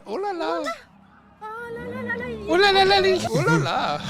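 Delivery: high, sing-song French "oh la la"; hammed-up French exclamation, drawn out; french chef. Mocking French rioter video.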